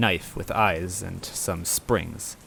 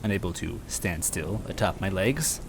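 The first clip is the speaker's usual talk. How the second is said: plain reading voice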